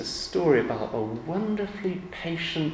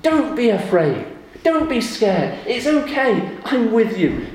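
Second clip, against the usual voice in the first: higher, louder calming command; God's comfort; 8 semitones higher, 6 dB louder, slower than usual. Retold or imitated. God speaking to frightened people, earnest and reassuring. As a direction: soothe the fearful with authority. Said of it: "God says to in the Bible".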